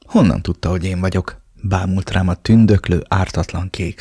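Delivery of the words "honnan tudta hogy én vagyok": ordinary delivery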